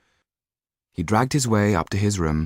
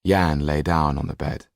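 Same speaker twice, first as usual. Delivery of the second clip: plain reading voice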